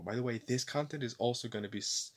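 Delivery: normal talking voice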